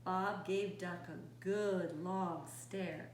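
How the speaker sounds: ordinary delivery, nothing marked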